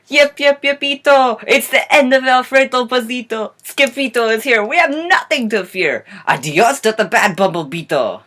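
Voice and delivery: loud, high-pitched storybook celebration; jubilant cheering. Acted. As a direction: cheer like an excited Chihuahua gang. Chihuahuas cheer the hero's arrival.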